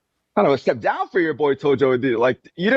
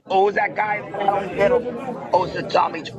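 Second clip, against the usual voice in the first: mock guy; 5 semitones higher than usual. Imitating the guy who recognized him.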